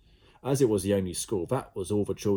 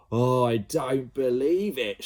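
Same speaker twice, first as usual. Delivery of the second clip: louder, slow, stunned disbelief; shocked teacher shout; 5 semitones higher, 6 dB louder, slower than usual. Acted. A teacher seeing the slime bird in the playground, stunned shout. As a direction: cry it out in shock. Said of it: "shouted one".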